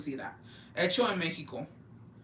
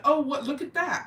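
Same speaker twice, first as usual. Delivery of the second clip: mock surprise; 7 semitones higher, 4 dB louder than usual. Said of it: "So I was like".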